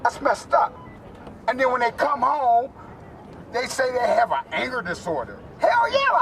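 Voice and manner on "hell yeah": high-pitched, exclaimed comeback; emphatic retort. Real, not acted. Veteran answers the anger disorder label, emphatic retort. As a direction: leap up in pitch, defiant.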